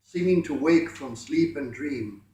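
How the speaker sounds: ordinary delivery, nothing marked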